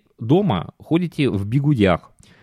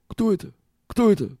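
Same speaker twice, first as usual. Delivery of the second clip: shocked; 10 semitones higher than usual. Retold or imitated. Husband shocked at bare face.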